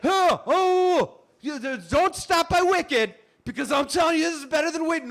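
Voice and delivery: high, loud breathless pitch; acted frantic hawking; desperate street promoter. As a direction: accost passers-by with a sales plea. Desperately pitching tickets to strangers.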